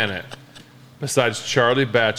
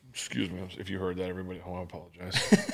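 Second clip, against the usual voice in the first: quiet apology to the viewers, voice much softer; embarrassed quiet aside; sheepish aside; 5 semitones lower, 12 dB quieter than usual. Real, not acted. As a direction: murmur a quick sorry to the people at home, ending in a laugh.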